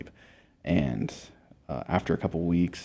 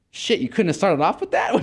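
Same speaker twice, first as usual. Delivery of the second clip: much higher, incredulous comic protest; mock exasperation; 12 semitones higher, 4 dB louder than usual. Patient chiding doctor for burying the news, mock exasperation. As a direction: blurt it out high, half amused.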